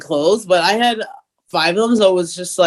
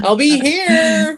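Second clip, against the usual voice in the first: mock dutiful; 9 semitones higher, slower than usual. Mock offering, paid to stay home.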